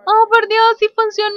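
high, loud happy exclamation; elated. Real, not acted. Gamer sees that it worked, elated. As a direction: cry out in delighted disbelief.

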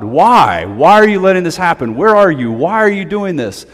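hammed-up desperate yell; yelling at God. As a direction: cry out at heaven in anguish. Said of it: "Job starts yelling at God".

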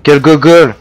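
high, loud cry of dismay at his own blunder; dismayed outburst. Real, not acted. Gamer after shooting his own teammate, loud dismayed yell. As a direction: yell at yourself for a stupid mistake.

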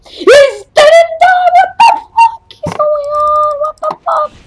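very high, loud shriek of outrage; shrieking disbelief. Real, not acted. Gamer shrieking when the enemy hero survives, very high and loud. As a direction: scream it in disbelief.

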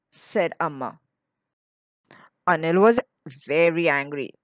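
ordinary delivery, nothing marked

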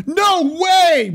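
high, loud disbelief; amazed outburst. Real, not acted. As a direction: exclaim it as if stunned by the news.

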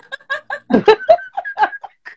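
long, very high-pitched laugh; high laughter. Real, not acted. Teasing a friend she loves, high real laughter. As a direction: burst out in a high cackle.

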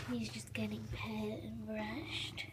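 hushed whisper, so the cat stays calm. Real, not acted. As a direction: whisper softly, slowing down. Whispering while cat is brushed.

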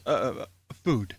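usual speaking voice

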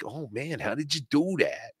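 very soft mock amazement; hushed, lightly voiced awe of a co-host; Joe Matarese. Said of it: "Joe Matarese would be talking like".

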